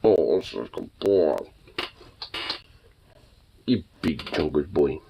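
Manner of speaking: soft low cooing; pet talk. Real, not acted. Owner coos fondly at her chubby dog.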